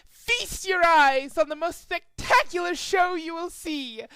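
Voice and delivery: high, loud grand announcement; showman announcer. Acted. Announcing Trixie's show to the ponies, high loud showman. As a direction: hype up the crowd like a ringmaster.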